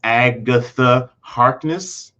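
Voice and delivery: "Agatha Harkness" slow, syllable by syllable; "Agatha Harkness" drawn out, lifting at the end; sounded out slowly. Shown as a demonstration. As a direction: spell out the wordplay, pulling "Agatha Harkness" apart slowly. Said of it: "You think about it".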